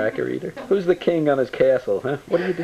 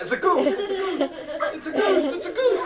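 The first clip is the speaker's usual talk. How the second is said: put-on high laughing, stretched baby talk; playful baby talk. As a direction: tease the baby with a sing-song.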